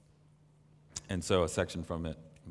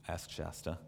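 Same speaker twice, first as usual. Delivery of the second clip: normal talking voice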